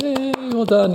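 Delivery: high, bright cheer; cheering classmates. Retold or imitated. Classmates praising the newcomer, high and cheerful. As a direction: cheer him on. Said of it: "everyone were like".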